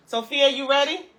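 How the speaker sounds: loud, high call across the house. Real, not acted. Mom calling out to her daughter outside, loud and high. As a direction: call your daughter to come in.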